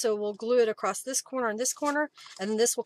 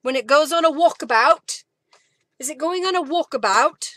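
louder, higher, badly faked English accent; terrible English accent; 6 semitones higher, 11 dB louder than usual. Crafter playfully talking of a walkabout, badly faked English accent. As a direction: put on a bad English accent, loudly. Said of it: "That's a terrible English accent".

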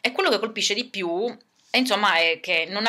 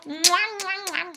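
high smacking air kisses; puppet air kisses; 9 semitones higher, much slower than usual. Acted. Puppet blows kisses to the fans, high and playful. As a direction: blow kisses goodbye like a diva.

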